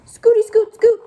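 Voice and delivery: high, loud, bouncy little duck; scooting duckling. Acted. Duckling arrives calling to the pigeon, high and bouncy. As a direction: scoot in cheerfully.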